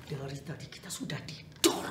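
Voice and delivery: tense whisper; frightened whisper among plotters. Acted. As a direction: hiss it low so he can't hear. Conspirators fear being overheard.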